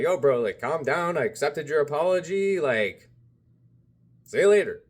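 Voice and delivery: loud, final, walking away from it; firm loud brush-off. Retold or imitated. Telling the man to calm down.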